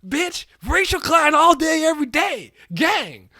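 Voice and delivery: high, loud hammed-up street tough; mock tough guy. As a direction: brag it like a cocky gang boss. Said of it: "He's like".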